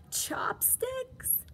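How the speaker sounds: high whispered question; surprised whisper. A narrator's reading. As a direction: whisper in disbelief. Underwear came back from China.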